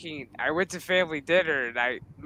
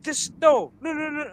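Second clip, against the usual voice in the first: high, stammered mock refusal; retold disbelief, pitched up; stunned dad; 7 semitones higher than usual. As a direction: imitate a dad whose brain is glitching. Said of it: "his brain is, like, kinda, like, glitching".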